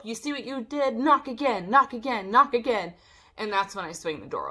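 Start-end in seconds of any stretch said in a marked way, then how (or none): 0.0-2.9 high, loud mimicked tirade; raised-pitch mock berating; imitated scolding scream. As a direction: mimic a neighbor yelling in rhythmic repeats. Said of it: "And then Drizella screaming".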